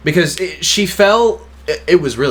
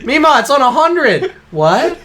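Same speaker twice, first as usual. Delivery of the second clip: calling to grandma; 11 semitones higher, 4 dB louder than usual. Retold or imitated. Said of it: "we'd be like".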